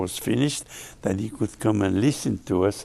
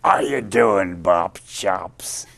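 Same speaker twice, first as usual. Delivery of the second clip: a light, gravelly-free hint of [name]'s greeting, a bit louder; [name] impression; 3 semitones lower, 6 dB louder, slower than usual. Greeting the young guitarist as Louis did, a mild [name] voice. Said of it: "He called me Bob Chops".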